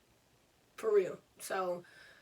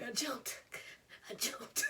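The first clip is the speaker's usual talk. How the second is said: acted-out pained hush; soft, voiceless retold confession; strained hurt whisper; 2 semitones higher, 6 dB quieter, slower than usual. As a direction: squeeze it out softly through pain. Hurt girl answers, holding pain in.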